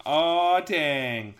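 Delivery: loud drawn-out groan of words; big, stretched exclamation; mock dismay. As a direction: holler it in playful dismay. Calls out dismay at a fight.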